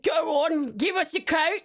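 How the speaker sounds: chavvy British peasant voice; playful British peasant accent. Acted. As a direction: put on a British commoner accent. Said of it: "in my peasant accent".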